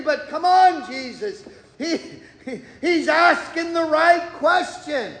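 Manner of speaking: hammed-up nudge, urging him on; a playful, exasperated churchgoer begging; mock pleading.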